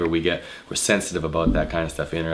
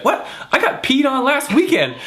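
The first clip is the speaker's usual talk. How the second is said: put-on much higher, louder girl's exclaim; 4 dB louder than usual. Girl answering the bouncer, high and loud.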